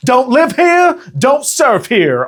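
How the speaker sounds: Australian local taunt. Retold or imitated. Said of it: "only in an Australian accent".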